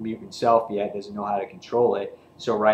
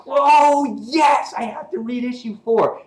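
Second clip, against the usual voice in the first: much higher and louder excited voice; gleeful fanboy squeal; 13 semitones higher, 9 dB louder than usual. Retold or imitated. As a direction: gush like you can't wait. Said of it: "I'm just like".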